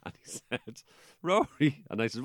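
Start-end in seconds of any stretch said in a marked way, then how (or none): 1.2-1.8 loud, high call of a name. Retold or imitated. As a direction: call across the room. Friend calling out in silence.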